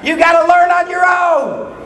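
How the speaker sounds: put-on fiery sermon warning the young; preaching at full force. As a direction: drive it home to the young.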